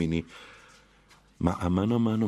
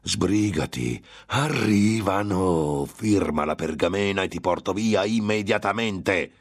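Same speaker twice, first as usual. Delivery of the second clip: urging demon; 4 semitones higher than usual. Acted. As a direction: press the victim to sign. Demon hurries the prisoner to sign.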